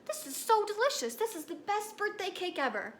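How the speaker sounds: high-pitched gushing over cake; delighted birthday kid. Pretend. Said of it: "you might say something like".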